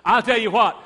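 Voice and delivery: loud, ringing declaration of a living God; preaching at full force. Acted. Preacher proclaiming to the congregation, loud full-force preaching. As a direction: preach it out, building with conviction.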